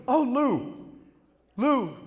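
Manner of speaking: high, breathy calling of a name; imitating the girl cooing at him, high; swooning girl.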